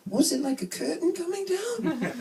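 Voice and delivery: put-on high-pitched New Jersey accent; squeaky-high Jersey nurse impression. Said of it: "this New Jersey nurse in Princeton".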